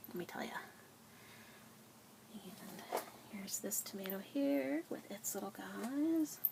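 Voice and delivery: soft whispered tour. Real, not acted. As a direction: keep it hushed, almost a secret. Whispered garden tour lines.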